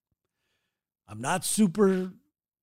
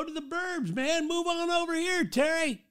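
imitating a friend's inviting call, much higher; mock-cheerful "come over here" from a well-meaning friend; friendly invite; 10 semitones higher than usual. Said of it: "some people would go".

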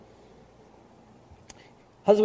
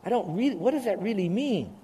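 puzzled listener; 5 semitones higher than usual. Retold or imitated. Preacher imitating a confused congregant, lightly higher. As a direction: mimic a confused listener asking. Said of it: "some of you are saying".

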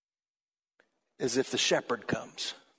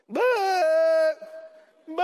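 high, crying little bleat; pitiful sheep bleat, much higher; 13 semitones higher, 6 dB louder than usual. Pretend. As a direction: cry out like a helpless stuck sheep.

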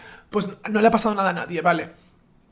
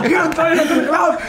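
imitating [name]; 6 semitones higher than usual. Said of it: "decía".

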